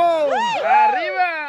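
high drawn-out yell; cheering shout. Acted.

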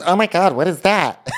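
high-pitched alarmed gasp of words; mock alarm. Cook's companion spotting something on oven rack, mock alarm. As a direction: exclaim in hammed-up shock, pitched high. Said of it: "They're, like, alarmed".

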